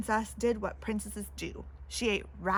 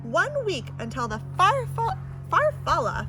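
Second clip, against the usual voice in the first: imperious; 6 semitones higher, 5 dB louder than usual. Acted. Princess imperious reply.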